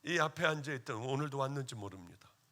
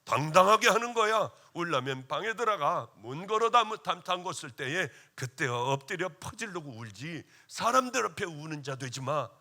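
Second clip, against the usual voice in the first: stern scolding shout; 7 dB louder than usual. Retold or imitated. Pastor retells scolding young ministers about crying, loud stern shout. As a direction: bark it like a tough preacher scolding. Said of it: "설교하면서 울거나 하는 깨깨대는 놈들은".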